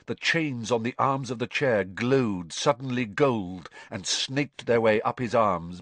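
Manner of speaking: plain reading voice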